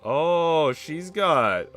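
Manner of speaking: normal talking voice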